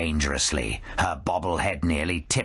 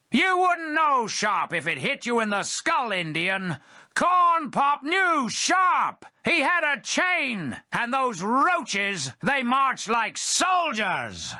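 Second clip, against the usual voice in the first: put-on irritated old-timer. Skeleton Joe rounding irritably on the Red Indian. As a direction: snap back, then boast.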